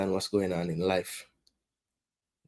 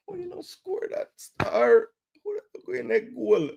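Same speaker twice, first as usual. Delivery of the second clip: real disbelieving fan; incredulous wail; 11 semitones higher than usual. Real, not acted. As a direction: wail at the miss. Disbelief at missed shot.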